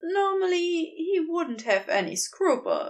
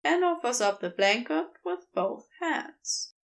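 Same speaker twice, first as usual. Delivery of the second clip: ordinary delivery, nothing marked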